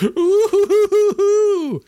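high, slow owl-like hoots; high hooting. Retold or imitated. Mock hooting at start of story.

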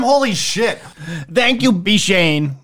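high-pitched, gushing fake excitement; mock excited. Host overdoing thanks to a sponsor, mock excitement. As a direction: gush over the sponsor. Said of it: "Could you sound excited about them?".

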